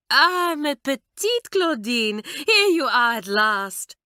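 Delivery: put-on high, loud French-accented gushing; French aunt's cry. A narrator's reading. Aunt greeting her niece with a high, loud French cry. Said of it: "cried Mamselle".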